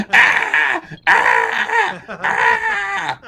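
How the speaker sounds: very high hammed-up shrieks; over-the-top falsetto screaming; mock screams. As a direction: shriek like burning comic figures. Voicing screaming comic figures.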